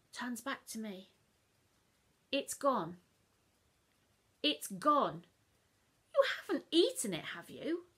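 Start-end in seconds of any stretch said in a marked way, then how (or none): 2.3-7.9 much higher, louder, fast mum voice; brisk morning question; Mum asking. Acted. Mum to child at breakfast, brisk higher voice. Mum finds cake gone.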